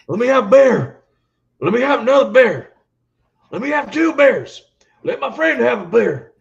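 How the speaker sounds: high, loud shouted wrestler orders. Retold or imitated. Ordering beers all night in a shouting wrestler voice. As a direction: yell each beer order like a promo. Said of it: "we do the voice".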